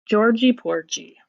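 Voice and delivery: usual speaking voice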